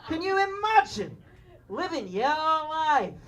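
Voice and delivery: slow, drawn-out mock northern English accent. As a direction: stretch out an exaggerated North of England accent. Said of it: "only express them like this".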